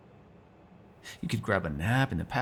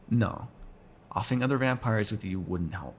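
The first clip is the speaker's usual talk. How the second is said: ordinary talk, nothing marked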